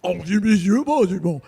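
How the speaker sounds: loud, heavy mock French; real-life French, exaggerated. Imitating French as really spoken, loud mock French. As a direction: push out hammed-up French. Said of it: "but it's, like, really, like".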